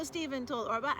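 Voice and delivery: usual speaking voice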